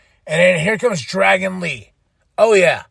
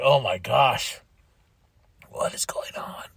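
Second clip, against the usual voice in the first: low voice sinking to a whisper; stunned hush; 6 semitones lower, 5 dB quieter than usual. Retold or imitated. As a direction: drop to a whisper in disbelief. Acts own stunned reaction.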